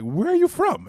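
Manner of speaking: incredulous high-pitched question; mock astonished question. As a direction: pitch swooping high at the end. Retelling a stranger's puzzled question.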